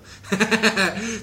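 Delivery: carcajada corta y aguda; ríe de su propia historia; risa. Real, not acted. Ríe tras contar la historia. (risa aguda real)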